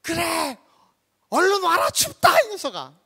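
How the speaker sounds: high, loud hearty call. Acted. Jesus telling Peter to come, loud high call. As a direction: holler for someone to come over. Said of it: "하시는 말씀이".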